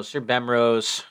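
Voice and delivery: ordinary talk, nothing marked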